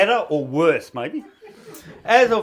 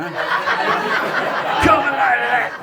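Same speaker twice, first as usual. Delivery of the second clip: high, very slow laughing holler; the mooning mate whooping, laughing; mate yelling; 9 semitones higher, much slower than usual. Retold or imitated. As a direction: drag out the mate's whoop. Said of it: "Some people will just moon your mother".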